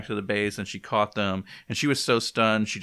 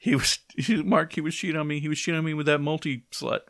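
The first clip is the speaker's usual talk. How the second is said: mock upset Eve; 6 semitones higher than usual. S0 acts Eve's outburst, laughing.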